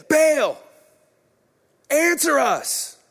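loud, high, slow desperate cry to Baal; prophets shouting. Acted. Prophets of Baal crying out to their silent god, shouting slowly. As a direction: call out to your god, stretching every word. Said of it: "They shouted".

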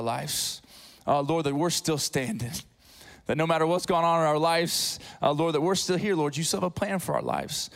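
low, earnest opening prayer. Acted. Pastor praying over the church, earnest and low.

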